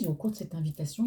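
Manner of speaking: usual speaking voice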